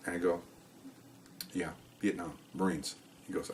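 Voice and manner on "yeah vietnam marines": very soft, understated answer; quiet, matter-of-fact. Retold or imitated. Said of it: "matter of fact, very quiet".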